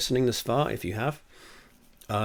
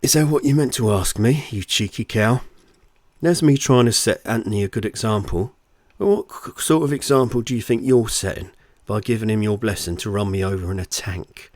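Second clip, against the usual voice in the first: loud, raised-pitch telling-off; indignant scolding; 8 dB louder than usual. A narrator's reading. Husband berates his wife over their son, indignant scolding. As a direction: tear into her, affronted.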